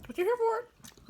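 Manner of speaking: silly high voice. Pretend. Mock voice to viewers.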